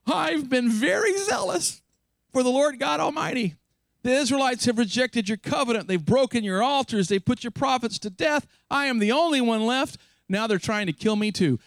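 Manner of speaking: mock-whiny rehearsed speech. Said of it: "It was a rehearsed speech".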